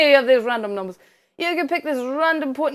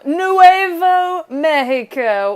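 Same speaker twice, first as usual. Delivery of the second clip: slow, loud Spanish pronunciation; hammed-up Spanish accent; 4 semitones higher, 8 dB louder, much slower than usual. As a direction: draw out a mock Spanish place name. Playful Spanish name for New Mexico.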